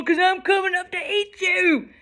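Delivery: high, threatening yell; troll's roar. Acted. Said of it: "roared the troll".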